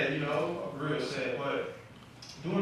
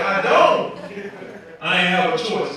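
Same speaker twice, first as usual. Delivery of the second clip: defensive uncle; 2 semitones higher, 11 dB louder, slower than usual. Retold or imitated.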